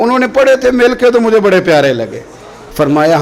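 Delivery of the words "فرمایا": ordinary delivery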